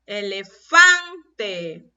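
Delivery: "Elefante" very slow, loud on FAN; stressed tonic syllable. Shown as a demonstration. Teacher confirms the strongest syllable, "Elefante" with heavy stress on "fan". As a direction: stretch out e-le-FAN-te and hit "fan". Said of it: "¿Cuál tiene la mayor fuerza de voz?".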